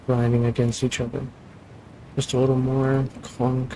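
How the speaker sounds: normal talking voice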